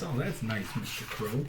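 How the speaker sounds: usual speaking voice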